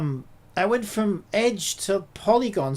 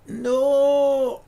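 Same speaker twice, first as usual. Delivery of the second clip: loud, high, drawn-out no; dismayed cry; 6 semitones higher, 8 dB louder than usual. Real, not acted. User sees the work vanish, loud dismayed cry. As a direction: wail it out in dismay.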